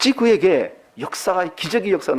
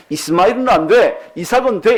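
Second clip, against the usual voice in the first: stern verdict; 4 semitones higher, 6 dB louder than usual. Pretend. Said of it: "이런 뜻이 아니란 말씀이에요".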